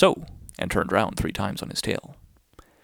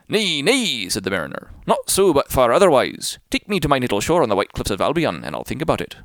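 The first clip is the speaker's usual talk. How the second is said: put-on defiant old sailor; 2 semitones higher, 5 dB louder than usual. The mariner defies the whale from inside, loud and stubborn. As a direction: refuse grandly like a stubborn sailor.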